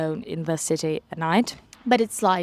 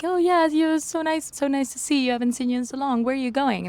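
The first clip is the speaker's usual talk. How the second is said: a light, higher friendly greeting; bouncer's cheerful greeting; 7 semitones higher, faster than usual. Retold or imitated. Bouncer greeting a regular, higher and delighted.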